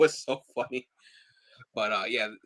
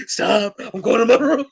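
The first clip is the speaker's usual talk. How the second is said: put-on whiny teen; 11 semitones higher, 9 dB louder than usual. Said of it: "I had her just going".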